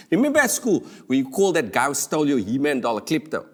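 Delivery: normal talking voice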